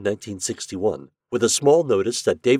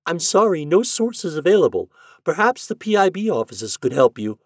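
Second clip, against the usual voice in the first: a much higher, polite woman's voice; human female voice; 10 semitones higher, 5 dB louder than usual. A narrator's reading. Librarian answers Shandor's call, polite female voice. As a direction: sound like a helpful librarian on the line. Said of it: "A female voice, quite human".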